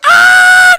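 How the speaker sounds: high, loud sudden shriek; mock terrified scream. Host playing a rider holding on for dear life, screaming. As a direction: scream as if the coaster drops.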